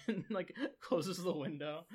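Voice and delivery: ordinary talk, nothing marked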